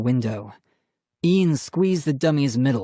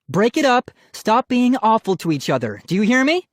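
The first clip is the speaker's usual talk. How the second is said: high, raised exasperated voice; stern scolding; 10 semitones higher, 4 dB louder than usual. A narrator's reading. Mom breaking up the fight, stern scolding. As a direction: scold them both firmly. Mother breaking up children's quarrel.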